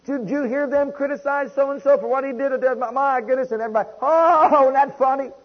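high, loud, gushing gossip voice, mocking it; mock gossiping churchgoers. Preacher mocking gossiping churchgoers, high and gushing. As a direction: ham up delighted church gossips trading rumors. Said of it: "I shouldn't be laughing at hearing rumors".

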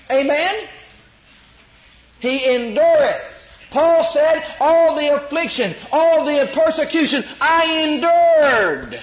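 loud, slow, high pulpit preaching that rises to a shout; fiery preaching. Acted. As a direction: preach it out hard to the congregation. Preaching at full force.

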